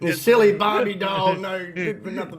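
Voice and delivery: high-pitched, hammed-up name-calling; mock insult tirade. Co-host mimicking the bad guy insulting her, mock berating. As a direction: imitate a wrestler hurling insults, starting loud.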